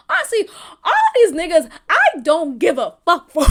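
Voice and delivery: loud, laughing and defiant; shouted through real laughter. Real, not acted. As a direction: laugh it out at full voice.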